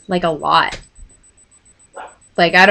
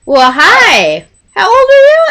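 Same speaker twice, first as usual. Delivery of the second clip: high, loud imitation of a flirty creep; exaggerated high-pitched flirting; mock flirty greeting; 10 semitones higher, 6 dB louder than usual. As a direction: swoop up high, hammed-up come-on.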